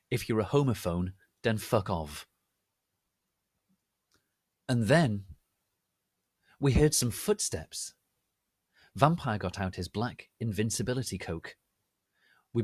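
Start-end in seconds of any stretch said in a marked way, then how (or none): none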